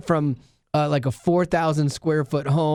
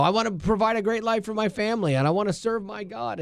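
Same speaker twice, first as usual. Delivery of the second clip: higher-pitched, lightly voiced eager pledge; earnest intention; 6 semitones higher, 3 dB quieter than usual. Man voicing his hopes for family and God, earnest. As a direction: lift it up like an earnest vow.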